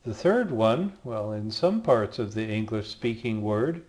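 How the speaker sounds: ordinary talk, nothing marked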